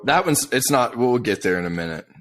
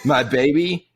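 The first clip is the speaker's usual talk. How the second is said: higher, louder, dragged-out sneer at the lyrics; mocking lyric reading; 7 semitones higher, 4 dB louder, slower than usual. Friend mocks the song's lyrics to his co-host, sneering. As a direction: read the lyrics to make them sound pathetic. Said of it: "you can't read lyrics like that".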